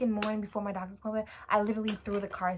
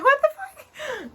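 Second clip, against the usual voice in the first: much higher and louder, slow and laughing; shocked laughing outburst; 12 dB louder, much slower than usual. Retold or imitated. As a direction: blurt it out in disbelief. Shocked by nurse's warning.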